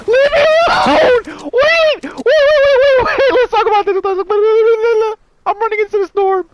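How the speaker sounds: very high, loud pleading shriek; frantic squealed wailing; panicked screaming. Real, not acted. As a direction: scream and beg the attacker off. Last enemy attacking.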